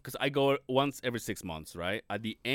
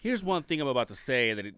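ordinary talk, nothing marked

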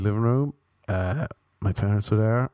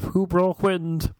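prim, posh mock; 8 semitones higher, much slower than usual. Family's prim euphemism.

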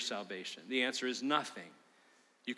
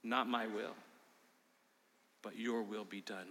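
quiet surrender; 3 semitones lower, 8 dB quieter than usual. Retold or imitated. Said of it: "this is what he says".

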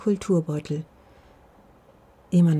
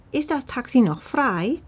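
grandma voice; 7 semitones higher, 3 dB louder than usual. Acted. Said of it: "Omamas Stimme".

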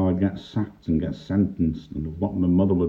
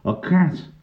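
higher-pitched short reply; lightly voiced dockworker; 11 semitones higher than usual. A narrator's reading. Clem answers the bobby about his box, pitched up. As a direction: answer the policeman in a raised pitch.

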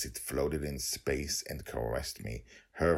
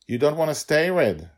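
put-on much higher, louder child's plea; little girl voice; 13 semitones higher, 12 dB louder than usual. Girl asking dragon to stay, high child voice. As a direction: voice a small girl begging him to stay.

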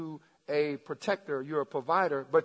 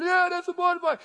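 raised, mildly hammed-up sideline cheer; imitated cheering parent; 12 semitones higher, 4 dB louder than usual. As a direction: cheer your son on from the stands. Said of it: "dad and mom is screaming".

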